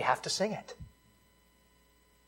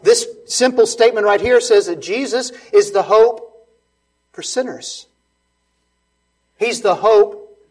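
louder, higher, driving each line home; full-force preaching; 5 semitones higher, 10 dB louder than usual. Acted. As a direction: preach it hard, hammering every phrase.

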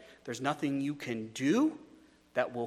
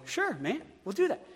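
mock donkey voice pitched way up, breezily agreeing; talking donkey; 10 semitones higher than usual. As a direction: squeak out a cartoon donkey's easy yes. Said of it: "And the donkey go".

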